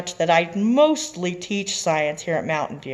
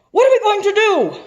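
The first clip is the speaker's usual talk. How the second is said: much higher, louder voice of a desperate president; panicked president; 11 dB louder than usual. Acted. President panicking over the crisis, high desperate wail. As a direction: wail it like a president at wit's end.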